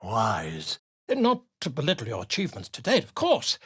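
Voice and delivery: normal talking voice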